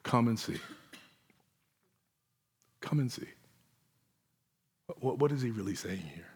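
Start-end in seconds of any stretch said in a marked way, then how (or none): 0.0-3.3 gentle invitation. Retold or imitated. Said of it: "He just says".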